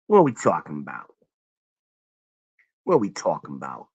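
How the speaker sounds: low, quick New York or Philly catchphrase. Retold or imitated. Streamer quoting another show's catchphrase, mock New York voice. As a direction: quote it in a put-on Philly or New York accent. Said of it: "I'm doing it in that New York voice".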